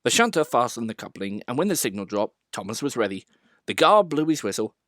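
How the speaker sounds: plain reading voice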